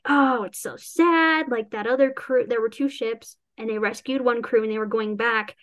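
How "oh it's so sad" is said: slow, drawn-out mock sorrow; a hammed-up pitying sigh; mock sad. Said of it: "they're all kind of like".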